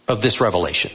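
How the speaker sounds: ordinary delivery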